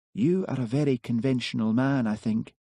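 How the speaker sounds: a gently voiced Highland girl; soft lilting Highland woman's voice. A narrator's reading. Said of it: "Her soft voice had a Highland lilt".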